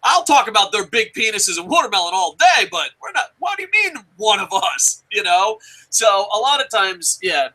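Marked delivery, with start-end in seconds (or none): 0.0-5.0 imitating his shocked objection; startled protest. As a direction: back off in mock alarm. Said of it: "And I was like".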